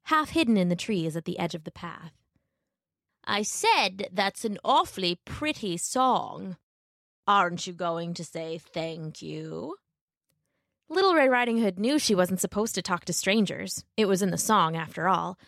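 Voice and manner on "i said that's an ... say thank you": slow, drawn-out wolf voice; sly wolf. A narrator's reading. Wolf prodding Little Red on the path, slow and leading. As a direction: draw it out like a storybook wolf.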